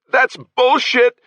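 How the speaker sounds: high, loud, drawn-out yell. Acted. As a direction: shout it out in outrage. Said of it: "the boy yelled".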